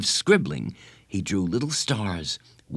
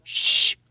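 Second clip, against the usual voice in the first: loud hissed shush; forceful "shh"; sharp shush; 5 dB louder than usual. A narrator's reading. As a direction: hush your friend urgently. Boy hushing his friend.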